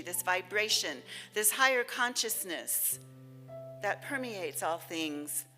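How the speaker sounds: ordinary talk, nothing marked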